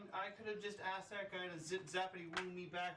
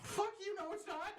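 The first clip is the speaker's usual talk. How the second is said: louder, higher, laughing while protesting; 10 semitones higher, 3 dB louder than usual. Real, not acted. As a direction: laugh out a mock comeback. Laughing mock outrage at a tease.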